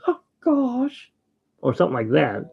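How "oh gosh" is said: high-pitched cartoon gasp of surprise; Goofy. Retelling a voice actor's Disneyland audition, imitated cartoon voice. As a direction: squeak it out as a goofy cartoon exclamation. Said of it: "he did the voice".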